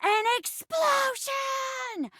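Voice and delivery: high, drawn-out cry of disaster; dramatic outburst. A narrator's reading. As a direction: stretch it out high like a blast going off. Narrating a sudden blast.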